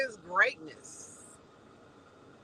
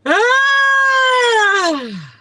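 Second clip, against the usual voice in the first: long loud high shriek; long high screaming laugh; 14 dB louder than usual. Real, not acted. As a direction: let out a long howl of laughter.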